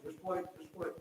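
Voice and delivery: soft voice from above, fading away; gentle heavenly urging, trailing to very quiet. Acted. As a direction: hush it down with each repeat. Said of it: "you hear this voice from heaven".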